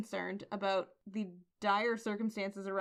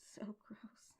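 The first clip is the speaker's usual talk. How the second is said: slow whisper of disgust; 6 dB quieter, much slower than usual. Real, not acted. Cohost reacting to the tongue-kissing demon, slow disgusted whisper. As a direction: breathe it out, grossed out.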